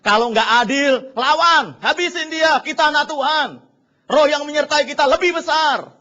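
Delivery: loud, high mocking bravado; hammed-up fighting talk; mock militant believer.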